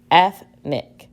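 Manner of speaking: "Ethnic" as eth, short pause, nick; syllable by syllable. Shown as a demonstration. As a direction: separate the two syllables of "Ethnic". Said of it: "let's identify each syllable in ethnic".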